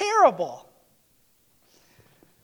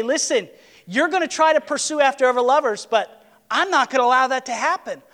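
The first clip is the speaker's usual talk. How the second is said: firm determined declaration; 6 semitones higher, 6 dB louder, faster than usual. Acted. God voiced, firm resolve.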